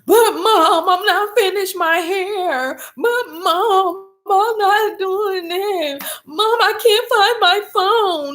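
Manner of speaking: put-on high, slow, crying child's whine; mock whiny teen. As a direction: imitate a whining daughter on the verge of tears. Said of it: "She wants to start crying".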